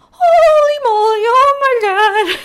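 high, loud panicked shriek; shocked shriek. Retold or imitated. Seeing the thief walk back into the store, shrieking in shock. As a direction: shriek it like you just saw a ghost.

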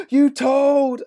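put-on high, loud childish wail; like a tattling little kid shouting; childish outburst. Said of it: "Homer's very childish".

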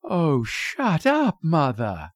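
drawn-out, high-pitched weary complaint; slow, tired brush-off to a mother; wearily exasperated daughter. A narrator's reading.

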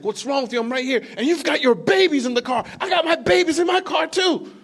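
angry stranger. Retold or imitated. Stranger scolding the preacher about the babies in the car, indignant shout. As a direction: scold him, rising in outrage. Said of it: "He's like".

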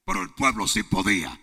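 loud preaching, slowing at the end; full-force preaching. Real, not acted. Preacher proclaiming to the congregation, loud preaching. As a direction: preach it to the rafters.

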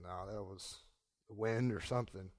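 low, very soft aside to oneself; acted quiet self-talk; muttered dismissal. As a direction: shrug it off under your breath. Peter dismisses the moving rope.

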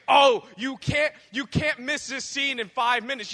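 excited loud friend. Retold or imitated. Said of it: "they were just like".

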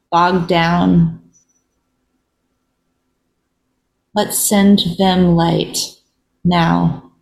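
unhurried meditation voice with long silences; guided reflection. Real, not acted. Host leading listeners in a moment of pause, meditative. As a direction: lead gently, leaving long pauses between phrases.

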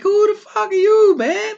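high-pitched, incredulous challenge; mock indignation. Locals confronting the new city boss, mock indignation. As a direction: challenge them, pitch shooting right up.

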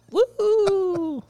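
high-pitched cheering "woo"; high fan whoop; fan's woo. Acted. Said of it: "be like a fan, like".

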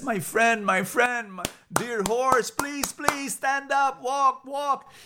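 high, slow begging pig voice; a little animal pleading, stretched out and desperate. Acted. Pig begs his dear friend the horse to walk, high pleading.

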